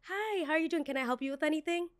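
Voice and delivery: high, sugary customer-service voice; overly sweet hostess voice; fake sweet greeting. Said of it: "exactly how it happened".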